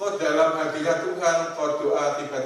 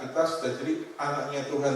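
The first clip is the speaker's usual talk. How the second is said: ordinary talk, nothing marked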